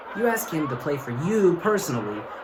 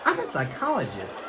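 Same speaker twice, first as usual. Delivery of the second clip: high, knowing accusation; psychologist calling out; 6 semitones higher than usual. Retold or imitated. Psychologist busting Roger's lie, high-pitched knowing. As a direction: catch him out, pitched up. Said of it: "She's like".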